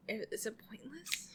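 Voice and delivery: hushed whisper aside. Real, not acted. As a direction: whisper the question. Aside question.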